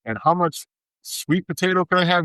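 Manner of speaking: high, pleading mock question; whiny dieter question. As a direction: ask like a confused dieter begging for rules. Julio mocks his own dieter questions.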